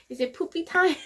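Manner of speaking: put-on squeaky playful child voice; cutesy toddler voice. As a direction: chirp it like a tiny child asking. Mother voicing her daughter.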